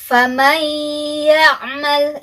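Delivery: FAMAN YA'MAL recited with N merged into a long humming Y; correct idgham recitation. Shown as a demonstration. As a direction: demonstrate فَمَنْ يَعْمَلْ as "famayy-ya'mal", hummed two beats. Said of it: "itu bacaan yang betul".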